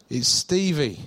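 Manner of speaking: lightly imitated Australian accent. Caller names himself to the pastor, in a light Australian accent. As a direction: give the name with a touch of Aussie. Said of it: "a bloke with an Australian accent".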